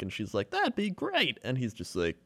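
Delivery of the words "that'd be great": high-pitched, polite retold reply; imitated eager woman. Voices woman accepting dinner invitation.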